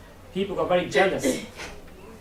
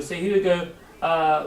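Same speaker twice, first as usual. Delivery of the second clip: ordinary delivery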